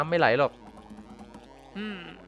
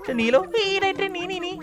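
squeaky hedgehog voice. Pretend. Voicing the fleeing hedgehog.

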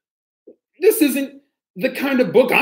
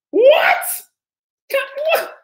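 very high shrieked what; mock shock. Reader shrieks in pretend disbelief at the book. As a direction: screech in fake disbelief.

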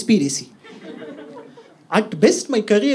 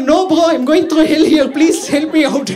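higher, louder pleading dog voice; dog's voice; 6 semitones higher, 5 dB louder than usual. Retold or imitated. Dog begging its owner for help, higher pleading voice. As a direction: mock-desperate plea, laughing. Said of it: "He's like".

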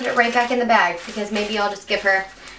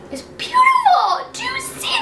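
real squeal of delight. Real, not acted. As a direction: squeal "It's beautiful" in delight. Says it twice, second squealed.

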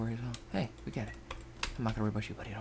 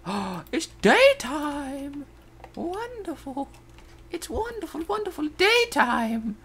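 high, drawn-out gushing; hammed-up delight; 13 semitones higher, 3 dB louder, slower than usual. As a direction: marvel in an exaggerated high voice. Hammed-up joy at reaching daytime.

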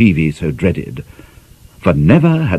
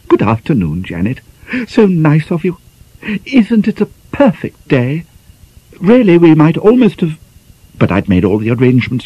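high, fluttering, flustered lady's voice; dithering over the baking powder tin; fussy spinster mistress; 8 semitones higher than usual. Acted. Flustered mistress giving her maid orders.

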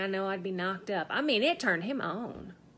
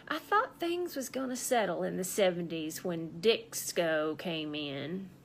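ordinary talk, nothing marked

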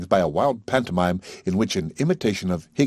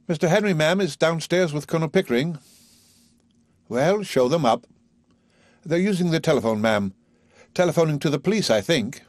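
higher, polite servant voice; deferential parlour maid; 8 semitones higher than usual. A narrator's reading. Parlour maid informing Mrs Higgins of visitors, politely. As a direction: announce the visitors dutifully.

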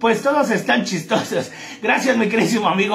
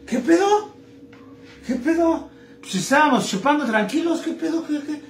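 much higher, shocked, rising questions; a drunk waking up somewhere he shouldn't be; baffled compadre; 7 semitones higher than usual. Acted. Compadre baffled on arriving in hell. (compadre desconcertado al llegar al infierno, voz muy aguda)

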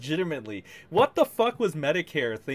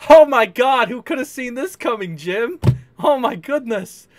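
higher, louder fake astonishment; mock shock; 9 semitones higher, 8 dB louder than usual. Streamer ridiculing Jim with loud mock shock. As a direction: gasp it out like nobody could have guessed.

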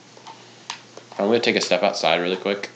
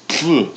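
sneezing; 8 semitones higher, 6 dB louder than usual. Real, not acted. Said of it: "Sorry, I had to sneeze again".

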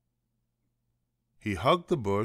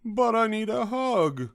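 high, loud plaintive character voice; pleading; 13 semitones higher, 6 dB louder than usual. A narrator's reading. Douglas pleading with the rabbit, high plaintive wail.

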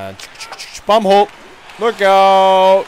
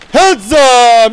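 shouted warning, louder and higher; loud call-out; 7 semitones higher, 9 dB louder than usual. Acted. Player warning opponents on the ice, loud shouted call. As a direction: yell it across the rink.

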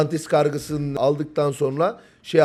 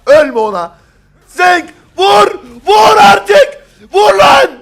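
very high, loud shouted orders; frantic screaming; 11 dB louder, much slower than usual. Real, not acted. Caster begs Zenk to hit, frantic screaming. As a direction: build to a full scream of command.

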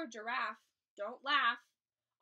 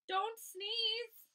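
pleading cry; 9 semitones higher, 3 dB louder than usual. Acted. Monkeys begging elephant.

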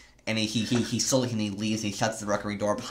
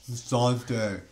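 put-on slow, drawn-out Grey Worm accent; Grey Worm impression; 5 dB louder, much slower than usual. Grey Worm warning Missandei to leave, in a slow Grey Worm accent. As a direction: stretch every word like Grey Worm. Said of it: "do like my Grey Worm impression".

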